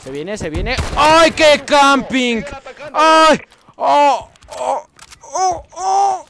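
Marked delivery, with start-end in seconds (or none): 0.9-2.4 drawn-out high mocking wail; mocking camper call. Real, not acted. Taunting an enemy camper, drawn-out mocking wail. 2.9-6.2 rising loud excited shouts; excited yelps. Real, not acted. Gamer yelping at kills in the match, excited shouts.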